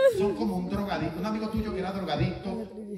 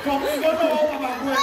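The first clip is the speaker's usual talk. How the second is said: mock defiance; 9 semitones higher, 7 dB louder than usual.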